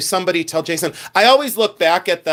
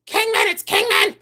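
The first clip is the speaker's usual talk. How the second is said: repeated very high squeals; mock scream. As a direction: imitate resellers shrieking the same answer. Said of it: "they scream".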